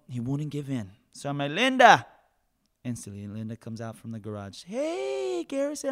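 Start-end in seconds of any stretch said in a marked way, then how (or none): 1.5-2.1 loud high call for Linda. Retold or imitated. Calling Linda out. 4.6-5.9 high greeting. Retold or imitated. Linda's greeting voiced.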